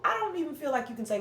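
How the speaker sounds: ordinary talk, nothing marked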